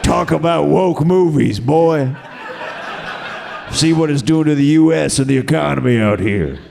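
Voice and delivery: a hint of a rural gun owner lecturing; gun-toting audience guy. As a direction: play the armed guy with a hint of twang. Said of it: "As he sets his A R fifteen".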